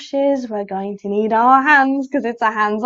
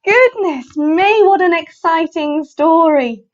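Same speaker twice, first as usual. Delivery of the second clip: animated, enthusiastic wonder; delighted amazement; 5 semitones higher, 6 dB louder, slower than usual. Real, not acted. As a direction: marvel at the story with big swoops. Reader reacts to exciting story.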